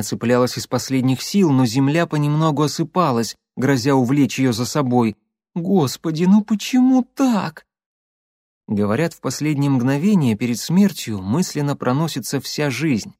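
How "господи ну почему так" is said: hanging on for dear life. Acted. Катя висит над обрывом.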